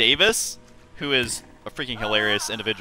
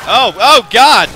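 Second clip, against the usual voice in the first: sudden loud startled cry; shocked shout; 10 semitones higher, 14 dB louder, slower than usual. Real, not acted. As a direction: blurt it out in shock. Sudden fright in game.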